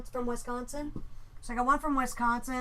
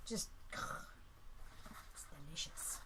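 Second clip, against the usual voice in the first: savouring whisper; 6 semitones lower, 15 dB quieter, much slower than usual. Real, not acted.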